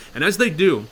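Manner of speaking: ordinary talk, nothing marked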